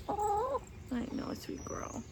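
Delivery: high, then fading to a whisper; whispered pet talk. Real, not acted. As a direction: coo softly, then breathe it out. Whispered cooing to the hen.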